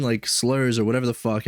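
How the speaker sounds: ordinary talk, nothing marked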